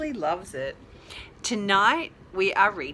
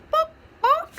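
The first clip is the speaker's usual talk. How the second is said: high, loud hen cluck; squawky little hen clucks; 7 dB louder than usual. Acted.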